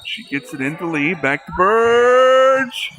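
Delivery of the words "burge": sudden loud exclamation at the play; excited outburst. Real, not acted. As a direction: blurt it out, caught up in the moment. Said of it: "Sorry if I'm getting excited there".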